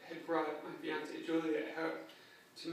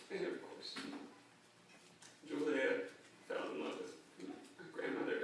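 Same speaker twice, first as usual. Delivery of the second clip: usual speaking voice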